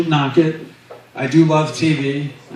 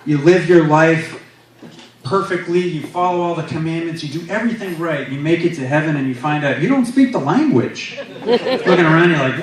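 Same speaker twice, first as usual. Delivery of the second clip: ordinary talk, nothing marked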